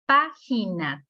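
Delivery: Página split into pá, gi, na; teacher spacing out each syllable of Página; syllable-by-syllable demo. Shown as a demonstration. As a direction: sound out Página in three separate syllables. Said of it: "tres sílabas".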